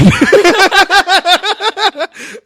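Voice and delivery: high loud burst of laughter. Real, not acted. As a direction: let the laugh explode out. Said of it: "I think I failed this round".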